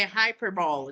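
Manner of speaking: hyperbole said as HY-per-bowl; hyperbole rhyming its last syllable with "bowl"; American mispronunciation. Shown as a demonstration. Teacher quoting Americans' mistake, hyperbole as HY-per-bowl. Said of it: "Americans like to say".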